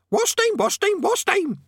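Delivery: very high, repeated squawk; piercing parrot cries; shrieking parrot. A narrator's reading. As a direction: screech it like an excited parrot. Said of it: "shrieked the parrot in his ear".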